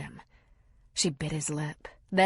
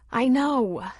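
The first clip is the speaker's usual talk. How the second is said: sharp, loud, higher exasperated reply; an exasperated wife; 7 semitones higher, 6 dB louder than usual. Acted. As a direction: let the frustration out. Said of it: "she said in an exasperated tone".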